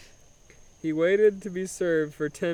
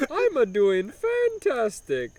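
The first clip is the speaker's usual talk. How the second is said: slow, higher hammed-up Italian; broken Italian accent; 7 semitones higher, 4 dB louder, slower than usual. Humdinger answering the speaker in a slow broken Italian accent. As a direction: beam out a fake Italian reply. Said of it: "obnoxious broken Italian accent".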